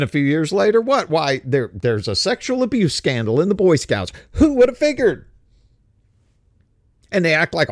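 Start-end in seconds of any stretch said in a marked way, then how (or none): 4.3-5.3 sarcastic mock surprise. Sarcastic punchline about the scandal.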